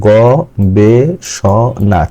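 গ-বে-স-না in four slow, separate beats; syllable by syllable. Shown as a demonstration. Teacher showing a polysyllable, গ-বে-স-না slowly broken into four. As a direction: draw out each syllable of গ-বে-স-না. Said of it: "তিনের বেশি আছে".

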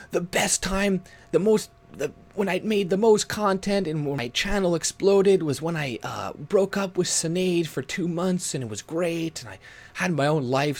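high, bragging mock voice; mocking Andy. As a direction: gush it like a smug streamer. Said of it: "when you're like".

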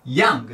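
"ヤング" with a quick, bursting ヤ; short popped vowel. Shown as a demonstration. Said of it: "弾ける". (「ヤング」のヤを短く弾く)

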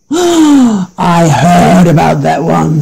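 slow, grave goat voice; big goat, grave. A narrator's reading. Big goat answering his brother, slow and grave. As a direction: say it slowly like a grim rumour. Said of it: "said the big billy goat gruff".

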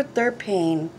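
ordinary delivery

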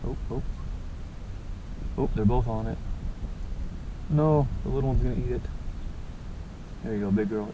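normal talking voice

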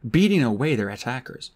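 usual speaking voice

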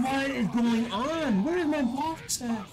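exasperated yell. Real, not acted. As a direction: stretch it out, fed up with the game. Gamer frustrated, not blocking.

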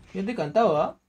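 normal talking voice

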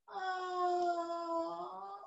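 long, soft, sleepy yawn; pretend yawn. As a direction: let out a quiet drawn-out yawn. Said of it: "somebody yawning on here because they're tired".

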